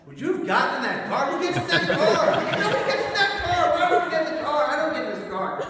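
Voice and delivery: very high, loud mock-outraged yelling; incredulous outburst. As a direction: shriek the question in comic disbelief. Comic outburst to audience about car.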